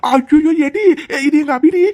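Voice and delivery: loud, high alarmed cry; shocked cry. Acted. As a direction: gasp it out in shock at the sight. Sees her girl being harassed. (அதிர்ச்சியில் உரத்த கூச்சல்)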